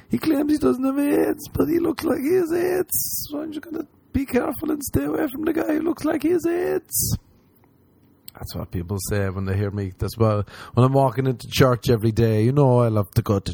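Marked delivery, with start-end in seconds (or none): 0.1-7.2 very high catty gossip voice; high mocking gossip; gossipy voice. Imitating gossiping people.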